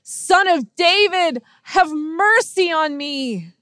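high, slow, drawn-out shout; imitated shout. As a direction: stretch the plea out loud and high. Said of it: "he shouts even more".

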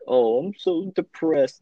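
hammed-up self-pity; mock whiny depressed voice. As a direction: drag it out like a sulky teen. Mocking a sulky person.

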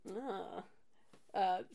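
ordinary talk, nothing marked

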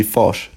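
"fosh" with a short "o" in place of i; exaggerated Kiwi vowel. Shown as a demonstration. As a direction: say fish as "fosh", mock New Zealand. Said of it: "fish would be fosh".

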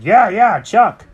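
excited birds. Acted. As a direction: agree all at once, loud and lifted. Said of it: "And they said".

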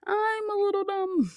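high, silly self-mocking squeak; mock yourself in a squeaky voice. Real, not acted. Self-deprecating repeat.

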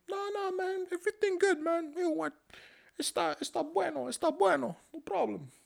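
very high, put-on Mexican accent; landlord's high fake Mexican accent; mock Mexican accent. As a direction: squeak it out in a silly Mexican accent. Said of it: "He wasn't Mexican".

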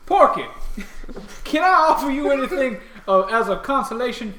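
high, loud shopkeeper offer; bright eager merchant; loud sharp voice. Acted.

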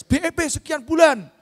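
high, loud hard-sell promise; franchise sales pitch. Acted. Franchise seller promising quick payback, loud mock pitch. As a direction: belt it out like an overeager seller.

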